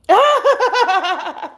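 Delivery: teary laugh, high; laughing through tears. Real, not acted. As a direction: laugh while crying happy tears.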